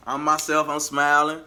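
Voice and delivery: usual speaking voice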